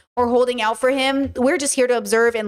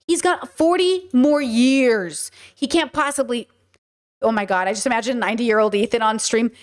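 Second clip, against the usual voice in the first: ordinary talk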